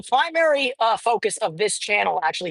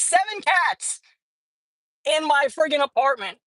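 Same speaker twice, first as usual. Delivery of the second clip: exasperated shout; 5 semitones higher than usual. Real, not acted. Counting his cats to the viewers, exasperated shout. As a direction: yell the count in disbelief.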